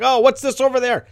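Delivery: loud, high mock wonder; put-on excited parent voice; distracting a child. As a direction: distract a hurt kid, big and high. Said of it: "Or change the subject".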